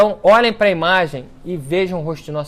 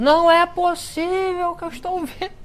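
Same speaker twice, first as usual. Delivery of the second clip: much higher shout of amazement; friar shouting; 12 semitones higher than usual. Retold or imitated. Friar at the miracle, shouting in disbelief. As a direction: cry out that you can't believe what you see. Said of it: "Ele começou a gritar".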